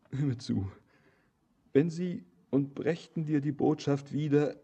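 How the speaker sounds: ordinary talk, nothing marked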